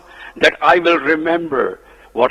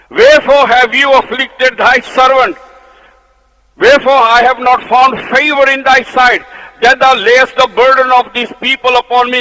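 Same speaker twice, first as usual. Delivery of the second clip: angry Moses; 8 semitones higher, 7 dB louder than usual. Acted. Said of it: "you can see saying he's in anger".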